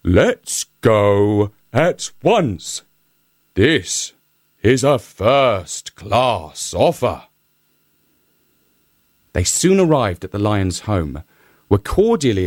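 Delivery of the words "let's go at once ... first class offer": slow, delighted donkey exclamation; donkey delighted by the marriage offer, slow; elated donkey. A narrator's reading. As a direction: savour the offer, slowly thrilled. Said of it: "greatly elated at this and exclaimed".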